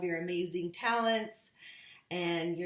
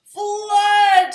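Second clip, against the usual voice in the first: much louder, much higher yell; shouted; 19 dB louder than usual. A narrator's reading. Mister Blair shouting about the flood upstairs. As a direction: bellow it in alarm. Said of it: "shouted Mister Blair".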